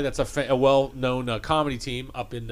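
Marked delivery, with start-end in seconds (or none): none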